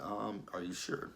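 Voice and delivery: doubtful aside. Retold or imitated. Doubting Q's claim.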